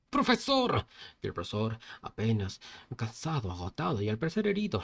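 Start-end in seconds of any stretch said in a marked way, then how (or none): 0.1-0.8 sharp high call across the room; shout. A narrator's reading. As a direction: call out his name in alarm. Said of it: "Y gritó". (grito agudo llamando al profesor)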